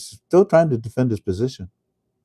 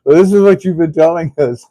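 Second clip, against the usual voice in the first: sarcastic; 6 semitones higher, 8 dB louder than usual. Retold or imitated. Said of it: "they're like".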